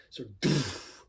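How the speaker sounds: soft, airy doof blown out; doof bass beat. Shown as a demonstration. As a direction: push air out in a doof. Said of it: "like you're blowing out air".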